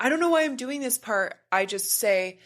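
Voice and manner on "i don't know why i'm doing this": fast, pitched-up flustered voice; flustered client. Retold or imitated. Client surprised by crying, high and rushed. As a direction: rush it out, high and bewildered. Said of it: "the part".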